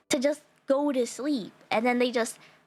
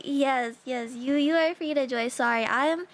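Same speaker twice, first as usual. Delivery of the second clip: usual speaking voice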